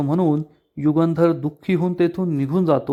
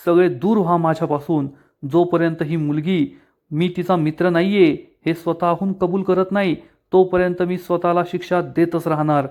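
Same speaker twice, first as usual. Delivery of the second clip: usual speaking voice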